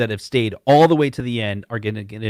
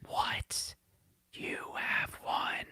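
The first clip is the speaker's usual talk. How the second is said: quick unvoiced whisper aside; 15 dB quieter, much slower than usual. Real, not acted. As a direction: whisper it so only he hears. Aside under his breath on camera.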